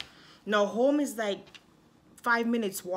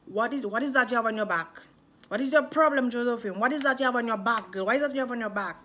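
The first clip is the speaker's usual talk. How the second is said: louder, pressing dad demanding an answer; dad grills his daughter about the tattoo, louder and insistent; 3 semitones higher, 5 dB louder, faster than usual. Retold or imitated. Dad questions her about tattoo.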